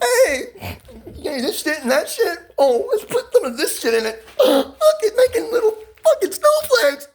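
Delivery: high, giddy and laughing through the words; like a kid showing off a trick at a party; excited party giggle. Retold or imitated. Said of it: "I used to be like".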